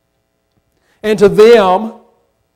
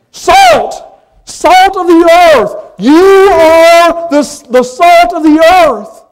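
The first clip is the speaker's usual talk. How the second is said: much higher and louder, with a slow hammering «You are the»; full-force preaching; 7 semitones higher, 5 dB louder, slower than usual. Real, not acted. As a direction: drive home each «Salt» at the peak. Preaching climax on salt.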